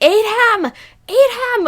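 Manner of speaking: excited high-pitched cheering; shouting at the TV. Retold or imitated. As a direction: egg the character on, yelling. Yelling at the screen, retold.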